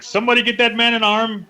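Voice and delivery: high, eager call out; mock-urgent fan cry; excited plea. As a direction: beg for it like a fan. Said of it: "I was all like".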